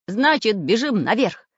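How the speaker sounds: rally them to run; urgent rallying. A narrator's reading. Samodelkin rallies his friends.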